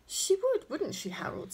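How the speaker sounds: high jab on the first words, then dropping; bitter. A narrator's reading. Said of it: "more than a trace of bitterness in her voice".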